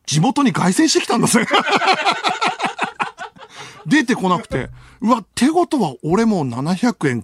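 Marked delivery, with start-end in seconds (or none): 1.5-3.8 long high burst of laughter. Real, not acted. Laughing at own story. (大笑い)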